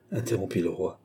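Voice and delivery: plain reading voice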